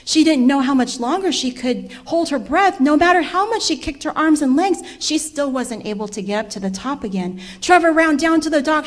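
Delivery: plain reading voice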